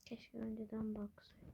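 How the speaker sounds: whispered regret under breath. Real, not acted. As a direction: breathe it out, barely voiced.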